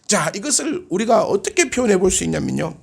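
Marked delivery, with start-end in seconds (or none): none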